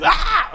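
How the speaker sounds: high frustrated shout of "Ah"; frustrated yell. Real, not acted. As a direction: let out a burst of exasperation. Frustrated, about to give up.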